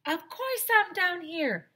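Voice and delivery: high, slow, distant cross yell; high indignant teacher yelling from far below; faraway angry teacher. A narrator's reading. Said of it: "yelled a faraway voice".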